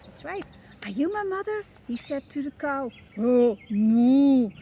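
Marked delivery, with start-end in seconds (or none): 0.8-1.6 high, small questioning chick; baby bird voice. Acted. Baby bird asking the kitten, high and hopeful. 3.2-4.5 loud drawn-out cow moo. Acted. The cow answering the baby bird with a loud moo.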